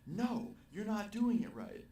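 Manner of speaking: high, loud, slow mock scream; retold scream. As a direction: shriek it out like a furious drunk girl. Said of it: "she screamed".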